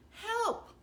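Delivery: put-on high, panicky boy's cry; boy crying for help. A narrator's reading. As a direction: yelp for help as a scared boy. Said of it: "the boy cried".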